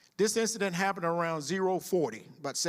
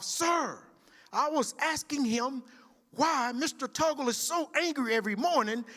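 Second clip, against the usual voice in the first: sounding off; 10 semitones higher than usual. Retold or imitated. Older recruit explaining her prayer, high retold sound-off. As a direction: rattle it off like a scared recruit. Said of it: "She sounded off".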